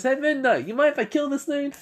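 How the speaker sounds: very high, loud casual request; high-pitched calling out. Retold or imitated. Boy asks his teacher to allow a kill, high calling voice. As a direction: call over brightly to ask permission.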